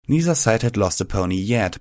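usual speaking voice

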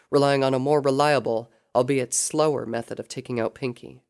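ordinary delivery

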